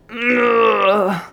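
long, high strained moan; hammed-up groan of agony; heart-rending moan. A narrator's reading. Cadet suffering in a headstand, heart-rending moan. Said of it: "heart-rending moan".

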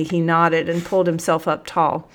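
usual speaking voice

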